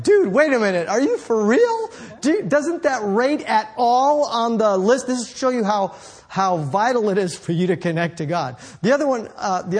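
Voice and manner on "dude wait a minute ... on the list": high, louder incredulous plea, slowing at the end; mock disbelief. Preacher voicing his reaction to the excuse, high and incredulous. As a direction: act like you can't believe the excuse. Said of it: "Like, like".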